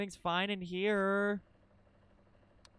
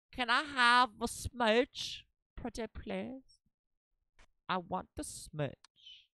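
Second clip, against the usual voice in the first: cutesy begging; 6 semitones higher, slower than usual. Pretend. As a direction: beg like a sweet child. Begs for a smooch.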